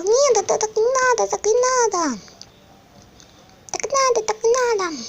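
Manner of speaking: high fussy little toy; squeaky toy voice. Pretend. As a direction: object in a tiny squeaky voice. (писклявый капризный голосок)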